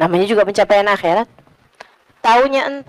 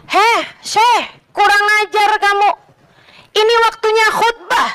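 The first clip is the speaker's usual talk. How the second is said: raised, higher voice scolding the sheikh; indignant telling-off, pitched up; scolding rebuke; 9 semitones higher than usual. Retold or imitated. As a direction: snap at him to stop. Man scolding the sheikh during sermon.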